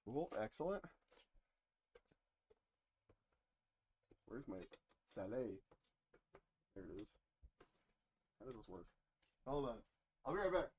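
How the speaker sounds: muffled inside a knight helmet; putting helmet on. Real, not acted. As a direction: voice boxed in by a helmet. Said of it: "I'm gonna put the helmet on real quick".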